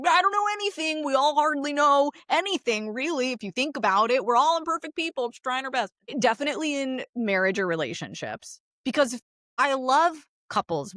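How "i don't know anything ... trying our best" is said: high-pitched, imitated plea of ignorance; self-deprecating disclaimer. Therapist telling family she's no expert, high-pitched and self-deprecating. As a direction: play up knowing nothing, pitched well up.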